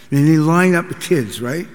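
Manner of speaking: ordinary talk, nothing marked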